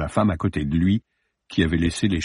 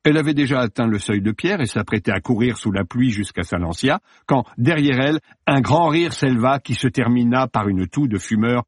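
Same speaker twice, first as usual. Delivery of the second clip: ordinary delivery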